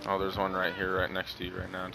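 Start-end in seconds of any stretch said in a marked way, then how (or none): none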